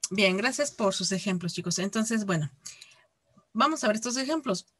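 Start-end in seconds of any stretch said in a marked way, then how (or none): none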